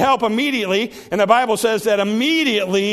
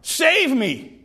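higher, punchy plea for rescue; acted desperate calling out; short urgent cry; 4 semitones higher, much slower than usual. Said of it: "Short, a short cry, to the point".

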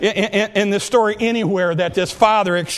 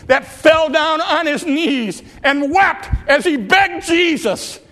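climbing higher and higher, pleading intensity; rising preaching climax; 5 semitones higher than usual. Real, not acted. Preacher to church, building passion over the father begging Jesus. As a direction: let the plea swell as he begs.

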